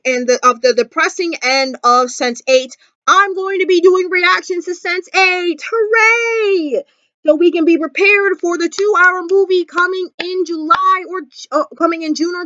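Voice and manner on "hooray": drawn-out higher-pitched hooray; cheering. Real, not acted. Vlogger announcing Sense Eight reactions, cheering. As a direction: cheer it out long and high.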